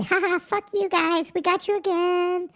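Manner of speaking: high mocking villain voice; mocking corporations. Mocking corporations gloating over taxpayers, in a high taunting voice. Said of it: "they're going to say".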